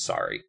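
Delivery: Sari said SAH-ree; audiobook pronunciation. Shown as a demonstration. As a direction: say Sari with an open "ah" first. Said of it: "I'm going with the audiobook".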